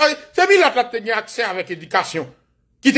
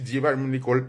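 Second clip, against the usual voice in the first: ordinary talk, nothing marked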